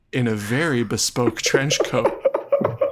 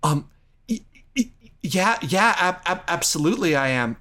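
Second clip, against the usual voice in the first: flustered stammering; 2 semitones higher than usual. Acted.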